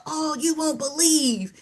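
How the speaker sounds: worked-up caller, lightly acted; venting to a friend. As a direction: slowly launch into an upset tale. Acts out upset caller starting to vent.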